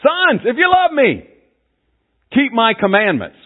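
high, loud fatherly proclamation; dad commanding his sons. Acted. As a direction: call out to your sons like a preacher-dad. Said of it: "and in my way".

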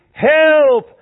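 high, loud cry for help from the cave; shrill repeated cry into the dark; desperate scream. Acted. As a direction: yell it out, lost and panicking. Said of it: "You scream".

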